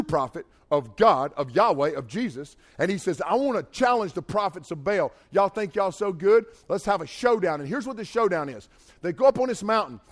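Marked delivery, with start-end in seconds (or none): 3.2-7.6 loud, taunting dare; bold challenge. Retold or imitated. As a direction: call them out, cocky and loud. Elijah challenges the prophets.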